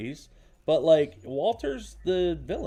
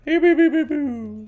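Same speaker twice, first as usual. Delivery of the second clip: put-on high cooing baby talk; cute baby voice; 10 semitones higher than usual. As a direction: coo it like talking to a baby. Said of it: "that's my little cute baby voice".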